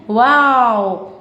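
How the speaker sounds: a very long, drawn-out sarcastic wow. Real, not acted. Vlogger mocking a contestant for laughing, with a slow sarcastic wow. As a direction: stretch the wow out in mock amazement.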